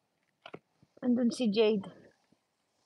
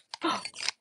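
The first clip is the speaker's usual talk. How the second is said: startled gasp; 11 dB quieter than usual. Real, not acted.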